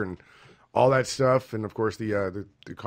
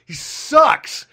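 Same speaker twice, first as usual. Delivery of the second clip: excited rant; 13 semitones higher, 9 dB louder, slower than usual. Real, not acted. Ranting about fighter.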